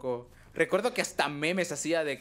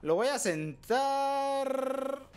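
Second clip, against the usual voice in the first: drawn-out announcement; 7 semitones higher, much slower than usual. Real, not acted. Streamer about to seat a captured player, drawn-out announcement. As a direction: stretch "sentar" out long and high. Narrating a move, last word held.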